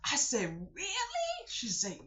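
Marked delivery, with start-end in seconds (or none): 0.7-1.5 very high playful really; high squeaky surprised really; mock surprise. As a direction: act amazed for a kid. Exaggerated surprise to child.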